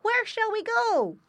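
high-pitched, loud piglet question; younger boy pig. A narrator's reading. Younger pig asks his brother, high and eager. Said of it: "said the younger boy pig".

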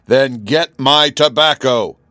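loud, high, slow roar; storybook monarch bellowing a demand; roared order. A narrator's reading. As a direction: roar it like an impatient king. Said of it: "roared the king".

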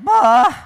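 loud, high grumpy groan; storybook groan of disgust; groaned "bah". Acted. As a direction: burst out with a sulky bah.

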